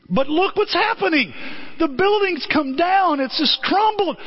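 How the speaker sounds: high, distressed mock protest; alarmed objection. Acting out objection to collapsing building.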